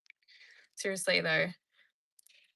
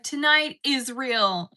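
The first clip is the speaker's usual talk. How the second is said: loud, high, drawn-out declaration; meme impression; 5 semitones higher, 11 dB louder, much slower than usual. Streamer quoting the meme's punchline, loud and drawn out. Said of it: "And she's like".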